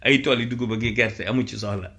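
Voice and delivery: ordinary talk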